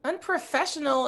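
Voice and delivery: high-pitched, offended disbelief; incredulous teenage comeback; indignant echo. Acted. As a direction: throw the word back, affronted. Indignant echo in acted quarrel.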